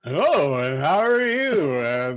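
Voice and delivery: camp it up like a stage baddie; mocking how the dubbed character talks, hammed-up; imitating Moses' voice. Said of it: "how he keeps talking like that".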